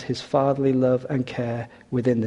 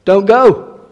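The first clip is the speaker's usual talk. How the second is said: calling out; 6 semitones higher, 10 dB louder, much slower than usual. Acted. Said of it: "the king said to him".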